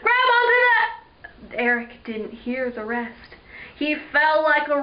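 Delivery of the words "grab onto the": high, loud panicked shout, cut off. Acted. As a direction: shout a desperate order that breaks off. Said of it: "Neil shouted".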